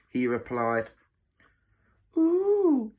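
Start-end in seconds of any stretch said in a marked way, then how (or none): 2.2-2.9 very high, loud drawn-out coo; mocking. Matron teasing a boy she has upset, mocking. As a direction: taunt with a long, high note. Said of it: "she added mockingly".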